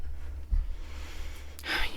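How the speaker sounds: long weary sigh; heavy sigh. Real, not acted. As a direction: let out a deflated sigh. Heavy sigh after sad comment.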